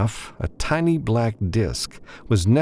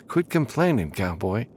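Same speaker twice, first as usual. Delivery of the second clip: higher, amused insistence; Sharon, teasing; 5 semitones higher than usual. A narrator's reading. Sharon insists on carrying Matthew, teasing and higher. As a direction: offer it with a grin in the voice.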